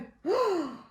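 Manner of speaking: sharp rising gasp; shocked gasp. Acted. Reacting to the theft plan for a child, gasping. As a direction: gasp in mock alarm.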